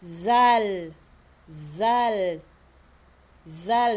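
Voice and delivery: "Zal" begun with voiced TH, loud and drawn out; tongue between the teeth, stretch "Zal" long. Shown as a demonstration. Teacher models the letter for repeating, buzzing TH. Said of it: "Sound like that".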